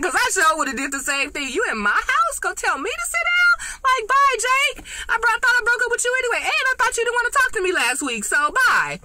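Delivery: high, sassy brush-off, quick in places; sassy dismissal. Retold or imitated. Viewer voicing Olivia telling Jake bye, high sassy scoff. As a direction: tell him off like he's out of line. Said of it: "I was like".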